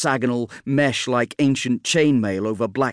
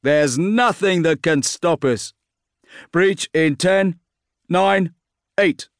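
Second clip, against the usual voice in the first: commander's countdown; 6 semitones higher, 3 dB louder, slower than usual. Acted. Bran counts down to breach.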